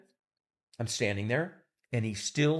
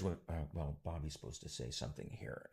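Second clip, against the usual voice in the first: much softer, lower hesitant aside; timid murmur; 8 semitones lower, 16 dB quieter than usual. Retold or imitated. Assistant timidly pointing out the missed line, soft and low. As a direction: murmur it nervously. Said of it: "Ruthie says".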